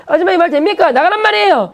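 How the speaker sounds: high, angry shout; the boy losing again and shouting at her; frustrated yell. Retold or imitated.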